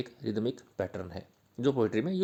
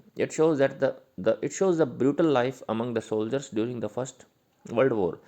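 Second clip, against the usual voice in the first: reading notes aloud slowly and loudly, word by word; slow dictation; 4 dB louder, much slower than usual. Shown as a demonstration. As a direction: dictate it so students can write it down.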